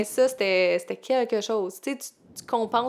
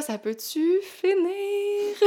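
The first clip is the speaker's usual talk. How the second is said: exasperated; 10 semitones higher, much slower than usual. Retold or imitated. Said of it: "Là, j'étais là".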